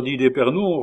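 ordinary delivery, nothing marked

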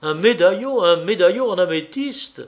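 amazed captain's outburst; astonished exclamation. A narrator's reading. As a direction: cry out the startling news as a question. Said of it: "s'écria le capitaine Belval".

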